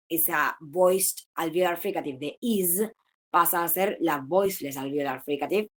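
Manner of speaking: ordinary talk, nothing marked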